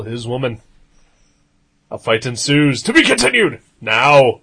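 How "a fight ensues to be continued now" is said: punchy dramatic comic narration. Pretend. Cliffhanger ending of the recap.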